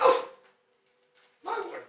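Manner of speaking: flustered queen. Acted. Queen caught without her glamours, high and flustered. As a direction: put on a high, dainty queen's voice. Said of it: "She's like".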